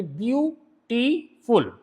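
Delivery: "Beautiful" slowly in three separate parts, view, टी, फुल; teacher sounding out "beautiful" syllable by syllable, very slowly; split into syllables. Shown as a demonstration. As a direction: break "beautiful" into view… टी… फुल, pausing between each. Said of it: "तीन part में बोलेंगी".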